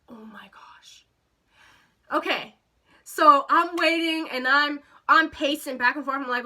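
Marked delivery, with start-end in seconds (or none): 0.1-1.0 low, barely voiced whisper; whispered dismay. Teller learning driver is twenty-five minutes away, whispered dismay. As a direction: whisper it in sinking disbelief.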